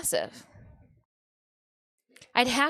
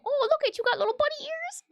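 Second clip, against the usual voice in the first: much higher cooing to the cat; cooing baby talk; 12 semitones higher, 4 dB louder, much faster than usual. Retold or imitated. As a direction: gush like talking to a baby. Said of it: "sometimes I'll go".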